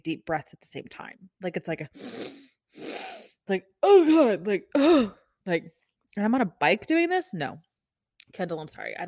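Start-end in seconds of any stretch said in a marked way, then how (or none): 3.8-4.5 much higher, louder strained exclamation; exaggerated cry of someone out of air; mock overwhelmed gasp. Nurse mimicking herself in bike class, overwhelmed gasp. Mimics gasping through second big breath. 4.7-5.1 high, loud exaggerated gasp; mock breathless gasp. Nurse imitating her bike-class struggle, breathless gasp. Mimics gasping through second big breath.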